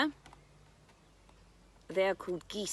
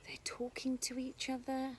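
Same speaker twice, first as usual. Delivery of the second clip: breathy hush, a touch higher; whisper; 5 semitones higher, 6 dB quieter than usual. Real, not acted. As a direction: whisper it like a secret. Quiet in garden to hear birds.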